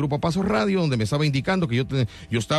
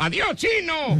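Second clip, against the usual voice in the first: mock street catcall; 13 semitones higher, slower than usual. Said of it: "me estén gritando".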